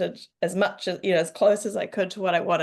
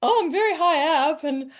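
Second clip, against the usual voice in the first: mock rising panic; 8 semitones higher, 4 dB louder than usual. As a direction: act out growing fear on the ladder, half joking. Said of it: "And I'm like".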